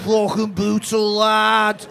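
slow, drawn-out hammed-up Scouse; mock Scouse. Comedian mimicking the Liverpool lad, slow mock Scouse. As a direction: stretch it out in an imitation Scouse accent. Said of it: "He didn't have a Scouse accent".

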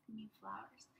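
soft unvoiced aside; whispered aside. Real, not acted. Teacher whispering an aside about flowers. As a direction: whisper the correction.

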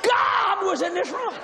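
high, loud, exasperated yelling; telling his wife God came, high shout; excited shout. Retold or imitated. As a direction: shout it at her in disbelief.

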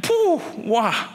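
hammed-up reaction to a slap; mock stunned gasp. Pastor reacting to a pretend slap, mock surprise. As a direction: puff out a mock-shocked wow. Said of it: "And I'm like".

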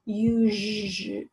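"usually" drawn out slowly on its zh; sounded-out word. Shown as a demonstration. Teacher sounding out a spelling word.

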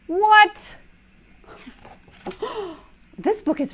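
high, loud startled what; shocked what. Acted. Reader to kids, loud shocked outburst. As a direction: jolt up in mock alarm.

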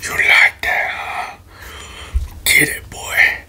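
normal talking voice

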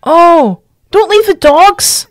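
high, slow cry of dismay; drawn-out, high distressed exclamation; dismayed plea. Real, not acted. Distressed for dogs under attack.

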